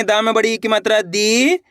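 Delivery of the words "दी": दी with a long rising ee; drawn-out vowel. Shown as a demonstration. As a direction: stretch the ee of दी upward. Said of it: "तो खींच के पढ़ेंगे".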